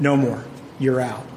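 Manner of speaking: hint of a stern mentor's rebuke; stern dismissal. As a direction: cut him off like a disappointed mentor. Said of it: "He just reamed him".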